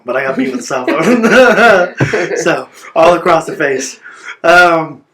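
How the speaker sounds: high, loud laughter breaking through the words; laughing in disbelief. Real, not acted. Laughing in disbelief as he tells the host about the beating. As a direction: laugh at how absurd it was. Laughing while recounting being beaten.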